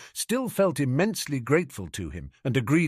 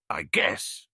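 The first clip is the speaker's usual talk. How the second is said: reluctant, low half-whispered admission; grudging; 6 semitones lower than usual. A narrator's reading. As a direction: concede it unwillingly. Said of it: "Ron admitted grudgingly".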